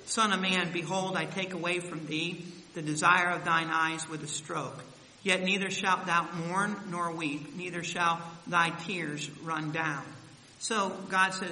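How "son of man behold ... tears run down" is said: loud, slow solemn Bible reading; scripture reading. Acted. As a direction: proclaim the verse slowly to the church. Reads scripture aloud.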